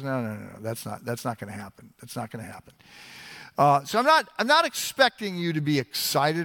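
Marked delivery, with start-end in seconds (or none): 0.0-2.7 much softer and lower, gently calming; doctor quietly reassuring a dying father. Retold or imitated. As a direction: hush the fear away like a kind doctor. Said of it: "the doctor says".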